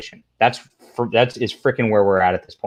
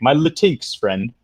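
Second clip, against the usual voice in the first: slow, lightly mocking imitation; mock-earnest activist, stretched out; mocking activist talk; slower than usual. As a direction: draw it out in mild parody of activists. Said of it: "they always talk like this".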